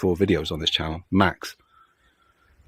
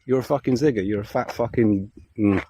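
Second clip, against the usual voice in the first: ordinary talk, nothing marked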